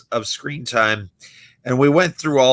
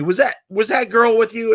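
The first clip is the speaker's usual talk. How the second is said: curious audience guy; 10 semitones higher than usual. Retold or imitated. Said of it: "one guy asked me".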